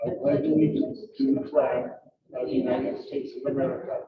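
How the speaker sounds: formal group-style pledge, phrase by phrase; pledge recitation. Real, not acted.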